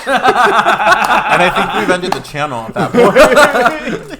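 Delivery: slow, loud, through laughter; laughing. Real, not acted. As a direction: drag it out while cracking up. Laughing at a bad joke.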